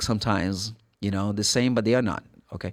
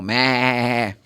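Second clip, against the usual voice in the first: imitated wavering sheep bleat; drawn-out animal "baa" imitation. Shown as a demonstration. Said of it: "sheep is an animal".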